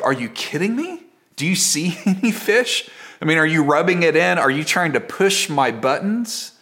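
high-pitched incredulous sarcasm; sarcastic. Acted. Preacher imagining himself a tired fisherman heckled from shore, sarcastic. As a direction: push back, exasperated, as if being needled. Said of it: "I get a little bit more sarcastic".

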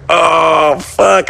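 high, drawn-out oh no; exaggerated sinking realization; awkward dismay. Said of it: "super fucking awkward".

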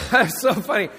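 laughing through the words. Real, not acted. Pastor cracking up over the failed T-shirt, laughing. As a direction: giggle while you say it.

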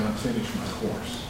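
low, hushed last words; low and fading, very soft; imagined deathbed words. Pretend. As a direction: say it softly, as if from a deathbed. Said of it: "laying on my deathbed saying".

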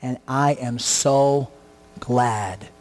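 slow preaching close. Acted. Pastor closing the sermon, slow and low. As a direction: wind the sermon down slowly, low.

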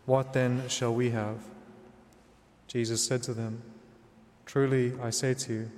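ordinary talk, nothing marked

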